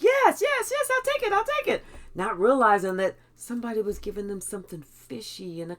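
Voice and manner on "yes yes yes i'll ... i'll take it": much higher and louder, grabbing at the offer; eager yes. Retold or imitated. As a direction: gush yes like you can't wait. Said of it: "they was like".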